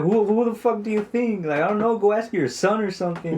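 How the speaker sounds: high-pitched mock exasperation; aggressive back-talk. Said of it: "my sister talks like that to my mom, like, aggressively".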